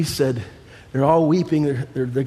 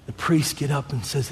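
ordinary talk

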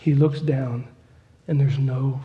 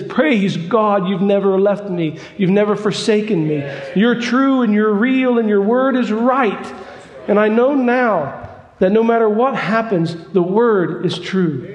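jubilant praise; 5 semitones higher, 4 dB louder than usual. Acted. Ed praising God aloud.

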